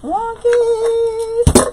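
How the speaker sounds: high, loud drawn-out squeal; playful squeal. Real, not acted. Crafter teasing the crooked mesh, squealing high. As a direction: sing out the word Wonky.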